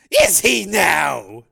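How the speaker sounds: mock her claim slowly; scornful snort. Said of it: "Rico snorted".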